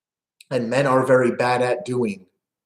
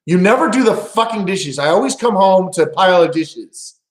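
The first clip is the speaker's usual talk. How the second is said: higher, louder mock nagging; mock angry yell; 6 semitones higher, 5 dB louder than usual. Wife yelling at husband about dirty dishes, angry shout. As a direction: play the fed-up wife yelling.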